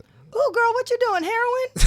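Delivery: high, loud teasing outcry; mock shock. Co-host teases friend about marks on the arm, loud mock shock. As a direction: pile on exaggerated, high-pitched alarm.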